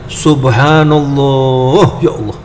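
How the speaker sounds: very slow, rising "Subhanallah" drawn out in wonder; a believer amazed by the flowers; in awe. Shown as a demonstration. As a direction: let the wonder stretch the word. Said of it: "Orang yang mengerti subhanallah".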